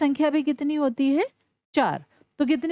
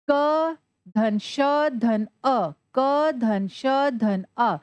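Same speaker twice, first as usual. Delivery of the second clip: क धन श धन अ spelled very slowly, each sound apart; teacher spelling the parts slowly to students; much slower than usual. Shown as a demonstration. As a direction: dictate the parts slowly for the class. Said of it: "इनको मिलाते हैं".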